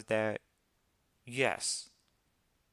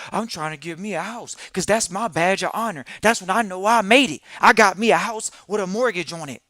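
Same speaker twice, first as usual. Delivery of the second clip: loud, high hammed-up brag of a proud homeowner; mock boast shouted about a mortgage as a badge; mocking bragging homeowner; 6 semitones higher, 12 dB louder than usual. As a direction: puff up and crow about owning a house. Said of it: "This is what they say".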